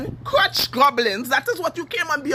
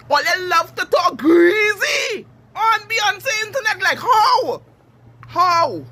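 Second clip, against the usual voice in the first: exasperated shout; 6 semitones higher, slower than usual. Real, not acted. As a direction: shout it out in disbelief. Ranting at internet trolls.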